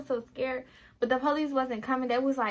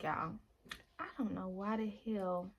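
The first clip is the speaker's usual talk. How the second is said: ordinary talk, nothing marked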